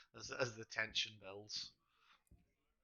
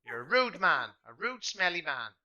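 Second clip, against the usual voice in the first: loud, slow, higher jab; angry insult; 7 semitones higher, 11 dB louder than usual. Acted. Pip insults the smelly man, loud and slow. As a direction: spit out the insult like a cross child.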